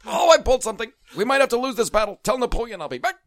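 high, loud hammy French soldier; French accent. Pretend. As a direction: ham up a straining French soldier. [name] in French Revolution bit.

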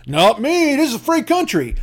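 high, puffed-up mock defiance; mock defiant customer. Customer refusing the manager, high mock defiance. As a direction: dig in like a stubborn shopper. Acted quarrel, customer's line.

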